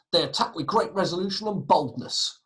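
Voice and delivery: rousing re-reading of the account; dramatic quotation. Acted. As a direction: proclaim it like a bold soldier. Re-reads the source in character.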